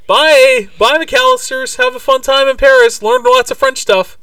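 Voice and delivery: put-on loud, very high kid voice; silly shrill send-off; pestering kid. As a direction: call out goodbye in a high-pitched, hammed-up kid's squeal. Acts neighbour kid calling goodbye.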